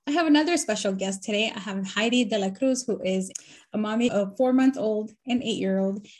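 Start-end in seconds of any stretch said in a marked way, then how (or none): none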